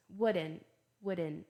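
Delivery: "wouldn't" ending on N, no T; American "wouldn't" with the T left out; silent T. Shown as a demonstration. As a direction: drop the T in "wouldn't", American-style. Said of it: "O T nem fala".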